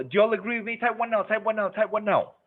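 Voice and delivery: high, nagging, repeated prompt; mock pushy presenter. Mocking how some presenters beg for answers, pitched high. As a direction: chant it at the audience like a pushy host. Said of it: "some presenters will do this".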